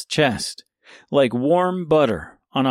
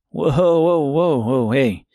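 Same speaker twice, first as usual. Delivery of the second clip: calling out whoa; 2 semitones higher than usual. A narrator's reading. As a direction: settle the wolves down. Tip calms the wolves.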